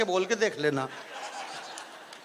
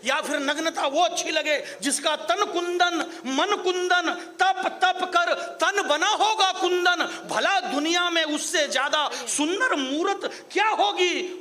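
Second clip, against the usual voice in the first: slow, drawn-out, higher verse recital; poetic recitation; 5 semitones higher, slower than usual. Acted. Poet reciting verse to the sammelan audience, slow and lofty. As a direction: savour each line like sung poetry.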